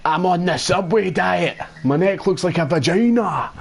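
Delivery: high, hammed-up Scottish villain; high-pitched mock-Scottish film character; Fat Bastard. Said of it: "same voice he used for Fat Bastard".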